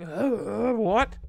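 loud, high outraged disbelief; retold sticker shock, hammed up; mock shock. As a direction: blurt it out, appalled.

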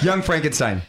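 ordinary talk

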